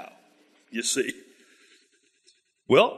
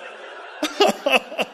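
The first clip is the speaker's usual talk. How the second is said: breathy laugh; 10 semitones higher than usual. Real, not acted. Preacher laughs at absurdity.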